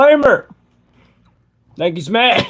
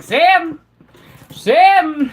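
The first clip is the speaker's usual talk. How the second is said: imitating a dice player calling out the name, higher and louder; Grits and Gravy yelling; 9 semitones higher, 5 dB louder than usual. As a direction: belt out the name like a hyped gambler at the dice game. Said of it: "He's like".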